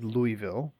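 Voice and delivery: LOUISVILLE as LOO-ee-vil; Louie pronunciation. Shown as a demonstration. Choosing a side from baseball bats, Louisville as LOO-ee-vil. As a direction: EE in the middle of Louisville. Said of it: "I'm gonna stick with".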